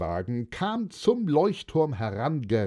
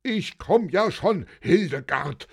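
raised, higher-pitched hurried call; 8 semitones higher than usual. A narrator's reading. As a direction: call back to an impatient wife. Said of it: "rief Günther".

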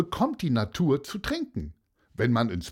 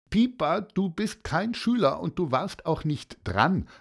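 mild telling-off, raised pitch; playfully stern; 7 semitones higher than usual. A narrator's reading. Teacher reminding Pieper it is not his turn, playfully stern. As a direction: scold lightly, amused underneath. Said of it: "sagte Fabian streng und schmunzelte".

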